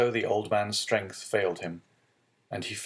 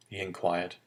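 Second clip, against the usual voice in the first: usual speaking voice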